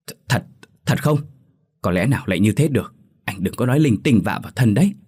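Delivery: stammer, then fire it off fast and sharp; half asking, half scolding; anxious snapping question. A narrator's reading. Doubtful neighbour grilling the young man, fast sharp scolding. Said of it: "hỏi mà như quát".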